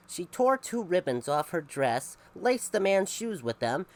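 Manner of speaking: ordinary delivery, nothing marked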